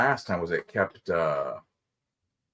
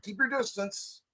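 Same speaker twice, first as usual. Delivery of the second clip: stern worker voice; 11 semitones higher, 6 dB quieter than usual. Retold or imitated. Imitating worker order.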